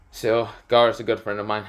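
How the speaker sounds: ordinary delivery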